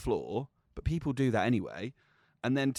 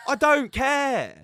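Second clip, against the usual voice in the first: higher, louder, each word slow and dismissive; slow retold defiance; 12 semitones higher, 9 dB louder, much slower than usual. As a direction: drag it out to show you don't care. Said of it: "I was going ballistic".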